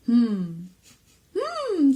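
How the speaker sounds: loud, high pondering hum; weird high hat voice. Acted. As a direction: ponder aloud as a strange talking hat. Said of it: "that kind of weird high voice".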